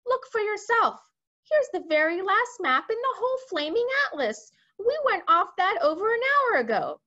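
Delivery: high, loud, insistent outburst; exasperated cry. A narrator's reading. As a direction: argue back as a frantic commander. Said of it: "cried the Air Force man".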